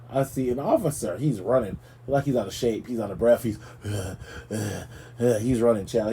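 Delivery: usual speaking voice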